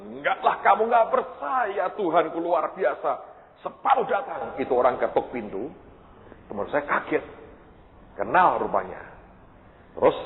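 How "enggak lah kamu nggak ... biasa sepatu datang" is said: high-pitched, drawn-out protest; excited insistence. Retold or imitated. Preacher insisting to his doubting friend, excited.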